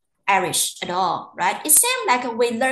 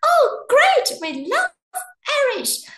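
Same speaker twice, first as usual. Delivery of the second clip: much higher, louder burst of delight; delighted locals; 5 dB louder than usual. Retold or imitated. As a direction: gush like a thrilled host. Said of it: "And then we say".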